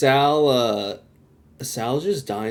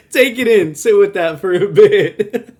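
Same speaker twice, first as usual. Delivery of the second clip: high and loud, cracking up mid-line; laughing quote; 9 semitones higher, 10 dB louder than usual. Retold or imitated. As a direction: repeat the line through laughter. Repeating Oz's line while laughing.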